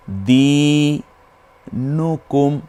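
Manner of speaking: দিনুকুম very slow, in separate parts; broken-up reading. Shown as a demonstration. Teacher breaks the verse up for pupils, slow segmented reading. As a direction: stretch দি long, then নুকুম. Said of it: "ভেঙে ভেঙে পড়ি".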